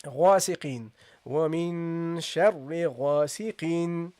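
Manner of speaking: teacher switches into a recited line; Quran recitation. Shown as a demonstration. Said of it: "So we have here".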